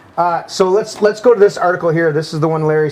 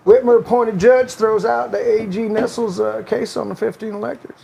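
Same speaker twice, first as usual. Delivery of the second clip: Bill Clinton voice; 4 semitones higher than usual. Retold or imitated. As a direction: read the article as a mild Clinton impression with a Southern accent. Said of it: "do Bill Clinton's voice".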